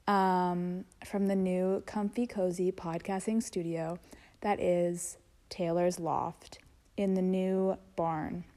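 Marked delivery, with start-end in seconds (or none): none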